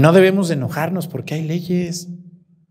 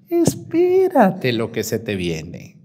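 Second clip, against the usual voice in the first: teasing warning; 7 semitones lower, 3 dB quieter, slower than usual. Acted.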